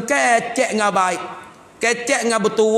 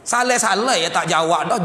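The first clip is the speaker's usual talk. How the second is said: normal talking voice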